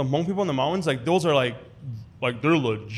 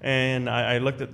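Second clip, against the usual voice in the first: usual speaking voice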